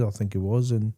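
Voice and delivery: usual speaking voice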